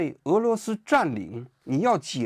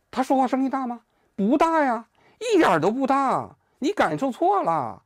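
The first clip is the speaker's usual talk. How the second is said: high, slowing mock denial of any shouting; mock Trump dismissal; 10 semitones higher than usual. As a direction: play Trump brushing it off, pitched high. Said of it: "哎，他说". (高声模仿川普否认大声)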